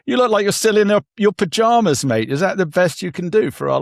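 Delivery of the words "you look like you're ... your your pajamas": amused teasing. Real, not acted. Teasing host about pajamas.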